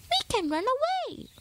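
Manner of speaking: high eager mouse voice; explaining little mouse. A narrator's reading. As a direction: lay out the plan, squeaky. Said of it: "Pip explained".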